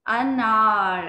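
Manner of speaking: अनार said very slowly and clearly; slow clear model word. Shown as a demonstration. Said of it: "इसकी जरा sound करते हैं". (बच्चों के लिए धीमे, साफ़ अनार)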